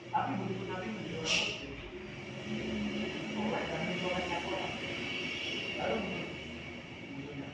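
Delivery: loud, high sermon peak; full-force preaching. Acted. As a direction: hammer it home to the congregation.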